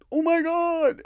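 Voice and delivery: high, loud mock shriek of alarm. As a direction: squeal it in fake panic, high and loud. Imitating the woman's shocked cry.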